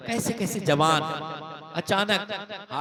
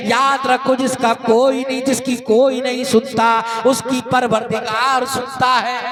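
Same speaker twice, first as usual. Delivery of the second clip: loud ringing sermon delivery, sustained pitch; full-force preaching; 6 semitones higher, 14 dB louder than usual. Acted. Preacher warns oppressors of the day of reckoning, full-force preaching. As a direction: preach it out to the whole congregation.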